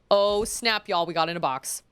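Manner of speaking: usual speaking voice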